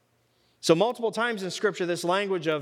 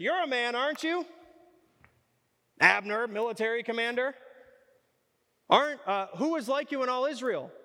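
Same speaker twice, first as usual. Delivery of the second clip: mocking trash talk; 4 semitones higher than usual. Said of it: "There is trash talk in the Bible".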